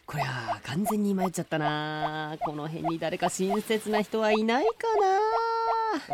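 a polite talking turtle, worried and lost; lost turtle, fretting. Acted. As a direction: fuss to yourself like a lost little turtle.

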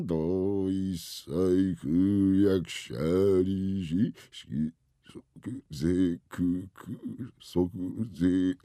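very slow, drawn-out syllables; halting reading. Acted. Father laboriously reads a sign aloud, haltingly. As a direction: puzzle out the words piece by piece.

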